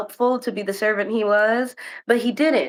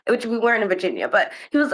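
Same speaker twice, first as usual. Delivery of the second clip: ordinary talk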